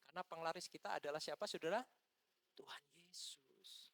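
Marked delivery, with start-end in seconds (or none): 2.5-3.9 slow, reverent whisper; hushed whisper. Real, not acted. Preacher answers his question to the congregation, slow whisper. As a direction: whisper it slowly, drawing out the name.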